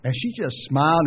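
normal talking voice

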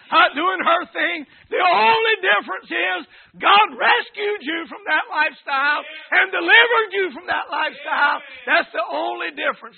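loud, high-pitched pulpit exhortation; fervent preaching. Real, not acted. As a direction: preach it hard, punching key words loudly.

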